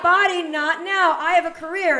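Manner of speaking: wag a finger at your own body; scolding her body. Retold or imitated. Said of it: "And I'm just like".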